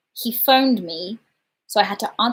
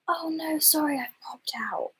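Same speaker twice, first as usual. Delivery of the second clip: hushed whisper into the phone, hiding from the door; breathless hiding whisper on the phone; 7 semitones higher, 9 dB quieter than usual. Retold or imitated. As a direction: whisper so the delivery man outside can't hear. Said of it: "I have to whisper".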